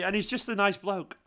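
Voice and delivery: ordinary delivery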